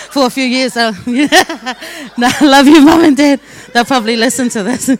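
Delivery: real laughter breaking through the words; laughing. Real, not acted. Speaker teasing Mum and Dad who may listen, laughing. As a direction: talk through a loud, high laugh.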